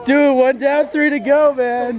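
loud high hype call; excited hype. Real, not acted. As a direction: pump up the crowd. Hyping the challenge.

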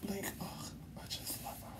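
hushed, delighted whisper. Real, not acted. Admiring her new chest to viewers, whispering. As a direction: breathe it out like a secret.

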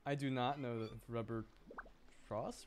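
normal talking voice

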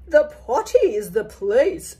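firm, loud queen's decree. Acted. The queen telling the princess, firm and loud. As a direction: lay down the law like a queen. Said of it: "said the queen".

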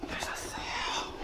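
hushed whisper. Real, not acted. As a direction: breathe it without voice. Unvoiced whisper.

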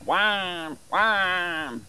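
crow call Wahn. Retold or imitated. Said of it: "called Wahn".

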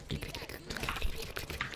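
unvoiced, whispered clic sounds; whispered clicks. Shown as a demonstration. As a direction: breathe the clics out softly. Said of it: "Lo que más se escucha son clic".

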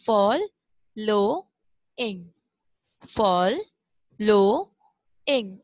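फॉलोइंग very slowly as fol, lo, ing; broken into syllables. Shown as a demonstration. Teacher breaking a word up for pupils, syllable by syllable. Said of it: "अब जब आप इसको बार-बार".

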